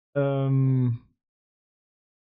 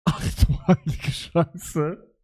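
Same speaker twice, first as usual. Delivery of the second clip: slow, drawn-out stunned disbelief; 3 semitones lower, slower than usual. Real, not acted. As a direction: let the shock sink in slowly. Shocked at the generated picture.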